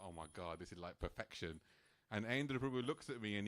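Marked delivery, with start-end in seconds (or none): 0.1-1.6 awed hush. Retold or imitated. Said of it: "I'm just looking there like".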